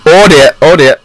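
loud, high "oh dear" with falling ends; loud alarm. Real, not acted. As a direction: cry out in dismay. Alarmed reaction in game.

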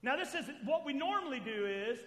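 normal talking voice

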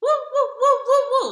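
celebrating. Retold or imitated.

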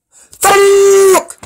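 high, very loud, drawn-out shout; frustrated yell. Real, not acted. Player reacting after choosing the red light, frustrated yell. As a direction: let out one long exasperated yell.

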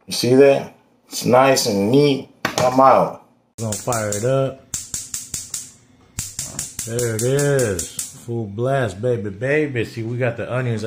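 ordinary delivery

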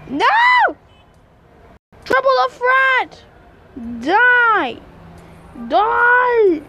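loud high battle screams; screaming. Real, not acted. Screaming during game battle.

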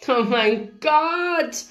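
drawn-out, high-pitched exclamation; sudden cry. A narrator's reading. Paige spots the guitarist online, sudden stretched cry. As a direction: stretch it out in astonished excitement. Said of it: "rief Paige auf einmal".

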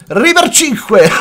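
high, loud whoop of triumph; excited shout. Real, not acted. Gamer shouts at a lucky enchant, thrilled and loud. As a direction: yell the win out high and loud. Gets rare enchant on stream.

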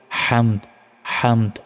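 হামদ with a clear final দ; correct pronunciation. Shown as a demonstration. Teacher repeats the correct ending, careful pronunciation. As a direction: end হামদ firmly on দ. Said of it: "বলতে হবে হামদ".

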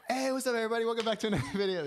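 high, fast, upbeat vlogger greeting; YouTuber intro voice. Retold or imitated. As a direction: fake cheerful YouTube intro. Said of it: "we'll just fake it".

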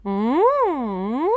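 slow gentle hum wandering in pitch; gentle hum walk. Shown as a demonstration. Coach demonstrates gliding mm hum. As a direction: take the hum for a tiny walk. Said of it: "You have a go".

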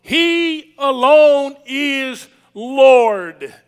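slow, forceful pulpit proclamation; emphatic preaching. Real, not acted. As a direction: drive each phrase home to the congregation.

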